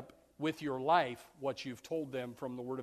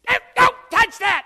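very high, loud shrieked don't; scolding mother; 14 dB louder than usual. Acted. Mother in a grocery aisle shrieking at her grabbing child. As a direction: screech it at the kid grabbing things.

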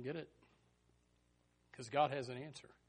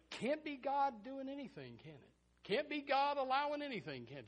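mock question; 10 semitones higher than usual. Voicing sceptics' question, mocking.

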